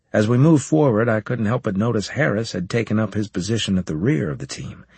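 usual speaking voice

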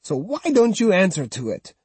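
high, mocking old woman's voice; taunting old woman. Old woman mocking the swordsman by his old name, high and sneering. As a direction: needle him like a spiteful grandmother.

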